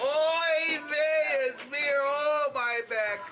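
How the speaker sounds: put-on slow, kvetching old woman with a Yiddish accent. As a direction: groan it out like a Yiddish complainer. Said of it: "Mishka would say".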